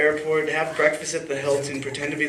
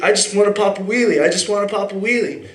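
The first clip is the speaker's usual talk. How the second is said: imitating a drunk guy, higher and louder; drunken insistence; 6 semitones higher, 4 dB louder than usual. Drunk stranger begging the bike's owner, mock insistence. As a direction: whine it out insistently, pitched up.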